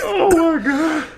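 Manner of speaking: high, loud, drawn-out exclamation; scandalized amusement. Real, not acted. Co-host hearing the outrageous punchline, scandalized outcry. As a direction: stretch it out high and loud, aghast.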